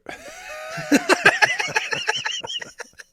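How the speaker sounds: shrieking laugh. Real, not acted. Reacting to username.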